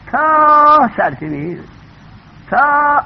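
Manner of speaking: long, loud, high "taa"; drawn-out emphasis. Real, not acted. Stretches "up to" listing books. («تا»ی کشیده و بلند)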